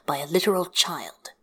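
ordinary delivery

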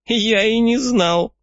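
put-on high, slow gloating old man; sly old man. A narrator's reading. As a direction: tease like a crafty old codger.

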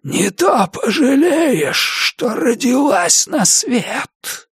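slow, high eerie threat; drawn-out ghostly warning; hissing ghost threat. A narrator's reading. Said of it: "Свистящий шёпот становился громче".

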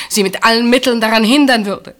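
heated outburst. Acted. Reliving the shouting at sister.

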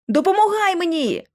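high, urgent cry for help; urgent exclamation. A narrator's reading. Lucy calls Holly to help her, urgent. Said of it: "вигукнула я".

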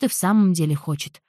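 plain reading voice

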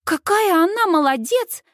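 high, thrilled praise; excited, high-pitched awe; delighted. A narrator's reading. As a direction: gush with admiration in a high voice. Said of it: "в восторге выдыхает Сьюз".

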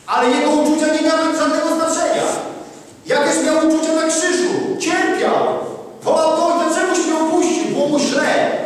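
high, loud preaching cry; fiery preaching. Acted. Preacher proclaims Christ's suffering to the congregation, fiery preaching. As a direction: proclaim it to the whole crowd.

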